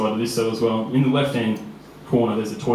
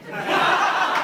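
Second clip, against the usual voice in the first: high burst of laughter. Real, not acted. Laughs after the story.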